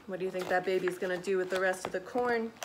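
usual speaking voice

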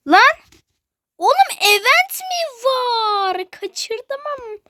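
high, loud yelp stretching into a wail; shocked outburst. Real, not acted. Player discovering a missed event, shocked wail. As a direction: cry out high, then fade while drawing it out. Startled to find a game event.

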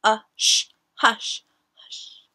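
playful hushed whisper; shushing whisper. Pretend. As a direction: hush them with a whisper. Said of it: "Just kidding".

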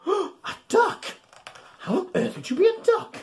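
gasping, high, wide-eyed disbelief; hammed-up shock; mock astonishment. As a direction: act amazed for the kids. Reading a silly animal rhyme.

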